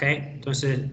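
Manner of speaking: normal talking voice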